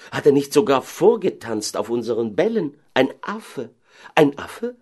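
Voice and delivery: high, aggrieved disbelief; lamenting. Acted. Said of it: "klagten sie alle". (klagend, fassungslos)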